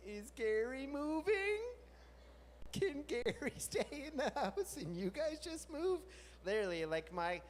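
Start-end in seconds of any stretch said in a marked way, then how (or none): 0.1-6.0 higher, slow, hopeful pleading. Retold or imitated. Asking the moving neighbors to leave Gary, higher slow pleading. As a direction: beg them to leave the dog. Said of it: "I was like".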